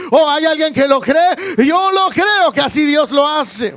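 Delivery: building to a loud, high, rousing shout; fervent preacher swelling to a climax; full-force preaching. Real, not acted. Preacher promising the congregation a miracle, full-force preaching.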